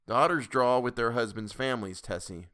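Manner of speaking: ordinary delivery, nothing marked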